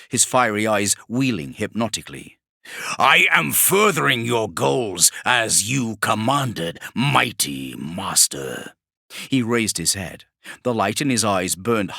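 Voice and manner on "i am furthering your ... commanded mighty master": mock-servile demon. Vashtor mocks Kravarek with false deference, slow. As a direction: flatter with hidden menace.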